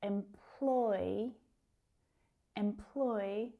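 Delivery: Employ slowly, with a long PLOY; em-PLOOY for Employ, unfinished rising tune; second-syllable stress. Shown as a demonstration. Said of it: "the stress is on that second syllable".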